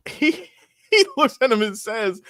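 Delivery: ordinary talk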